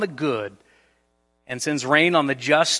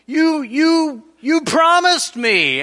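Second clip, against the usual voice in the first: whiny wronged friend; 11 semitones higher, 4 dB louder, slower than usual. Retold or imitated. Preacher voicing wronged self.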